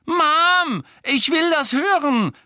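put-on high, complaining child's whine; loud wail. A narrator's reading. Daughter begging her mother to let her stay, wailing. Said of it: "klagte Ginny".

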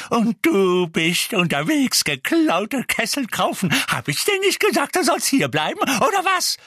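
high, loud, outraged shriek; shrill telling-off; furious scolding. Acted. Figg furiously scolds Mundungus.

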